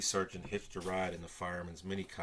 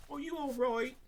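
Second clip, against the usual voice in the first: much higher, fluttery concerned voice; a flock of helpers clucking high and louder; fussing helpers; 6 dB louder than usual. A narrator's reading. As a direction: fuss over him in a high, anxious voice. Helpers fuss over Toby.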